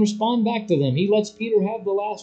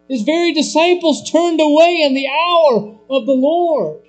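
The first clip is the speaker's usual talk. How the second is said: loud, slow, high pulpit warning; full force preaching; 9 semitones higher, 6 dB louder than usual. Acted. Preacher warning the congregation against forsaking Christ, loud and slow.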